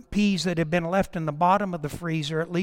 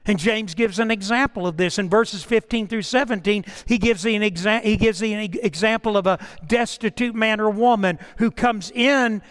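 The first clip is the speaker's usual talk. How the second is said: louder, impassioned sermon push; preaching at full force; 4 semitones higher, 6 dB louder than usual. Acted. Pastor preaching hard to the congregation about James. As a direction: drive the point home from the pulpit.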